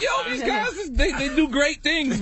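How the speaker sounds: high, laughing impression. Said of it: "I'm like".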